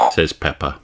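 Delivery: plain reading voice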